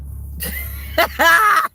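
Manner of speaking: a sudden loud burst of high laughter; real shout-laugh bursting out. Real, not acted. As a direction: cackle out loud at the comments. Laughing at the comments.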